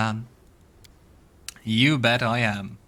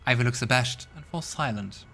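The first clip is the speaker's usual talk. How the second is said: normal talking voice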